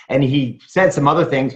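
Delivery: ordinary delivery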